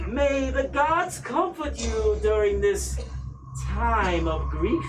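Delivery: high, slow, cold noblewoman voice; Lady Amelia. Acted. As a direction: speak as a haughty, grieving matriarch.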